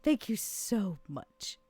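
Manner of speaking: slow, soft grateful voice; soft thanks. Acted. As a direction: breathe the thanks out slowly. Vera thanks Susie softly.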